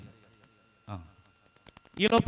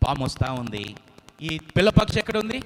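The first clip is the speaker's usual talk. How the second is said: usual speaking voice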